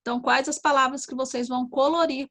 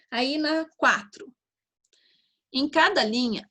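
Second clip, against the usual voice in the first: ordinary talk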